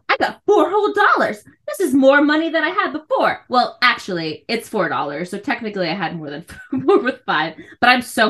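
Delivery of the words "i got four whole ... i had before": lightly voiced kid gushing, higher; a hint of a child showing off his gratitude; over-the-top kid voice. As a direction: son overdoing his thanks after being scolded, mock gushing. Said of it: "really putting it on".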